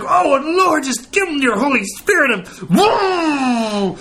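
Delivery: high, fervent plea breaking into a whoop; hammed-up charismatic prayer cry; Ricky overexcited praying. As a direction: cry out to the Lord, bursting with excitement. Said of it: "Ricky gets so excited".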